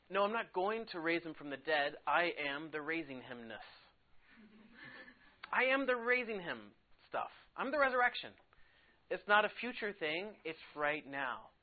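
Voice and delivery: emphatic Jesus quote. Retold or imitated. Teacher voicing Jesus to the class, building to a loud emphatic peak. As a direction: proclaim it like Jesus correcting them, rising. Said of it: "He said".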